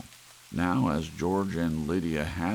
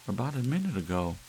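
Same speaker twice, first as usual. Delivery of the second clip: higher, fast insistence; wife's voice; 7 semitones higher, much faster than usual. Acted. Wife insisting to husband, higher and quick. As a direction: press the point quickly.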